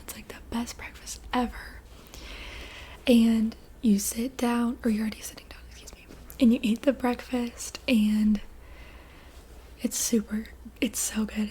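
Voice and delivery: ordinary delivery, nothing marked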